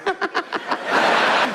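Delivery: high breathy mock cackle; a sudden delighted cackle; gleeful laugh. Fundamentalist seeing the atheist suffer, high gleeful cackle.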